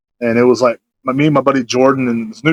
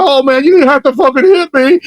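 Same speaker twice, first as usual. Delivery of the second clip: high, loud mock wail; imitated crying; 12 semitones higher, 5 dB louder than usual. Imitating the slapped man as he starts crying, mock tearful wail. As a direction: wail it like someone hurt and tearful. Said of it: "he just started crying".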